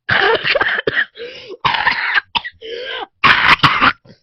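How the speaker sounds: loud, high hacking splutters; dying choking noises. Retold or imitated. Child imitating Vecna dying, long loud mock choking. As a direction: choke and gag in hammed-up death throes. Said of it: "Vecna was like".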